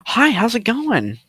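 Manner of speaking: slow, high-pitched Texan drawl; Owen Wilson. Acted. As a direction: stretch out a high, easygoing Texan greeting. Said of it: "I know it's Owen Wilson's voice".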